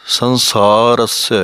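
ਸੰਸਾਰਸ spoken slowly, the final ਸ held apart; teacher's slow demo of ਸੰਸਾਰਸ; careful pronunciation. Shown as a demonstration. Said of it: "ਪਾਠ ਇਸ ਤਰ੍ਹਾਂ ਬੋਲਣਾ ਹੈ".

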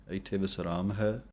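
ordinary talk, nothing marked

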